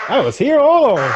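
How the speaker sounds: high, chirpy mock greeting; mock new guy. Game master teases the misnamed character with a high mock greeting. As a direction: pipe it up like a goofy newcomer.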